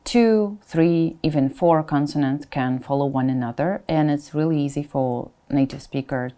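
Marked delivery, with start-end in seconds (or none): none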